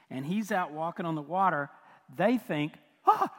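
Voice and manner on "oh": sudden high mock gasp of fright; terrified gasp. Preacher acting out disciples seeing Jesus on water, terrified gasp. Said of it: "they're just terrified".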